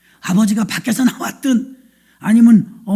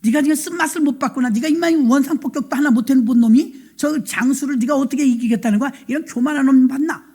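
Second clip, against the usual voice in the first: usual speaking voice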